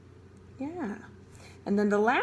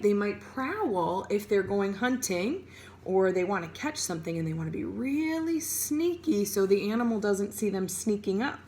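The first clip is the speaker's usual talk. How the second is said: ordinary delivery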